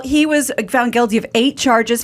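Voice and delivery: ordinary delivery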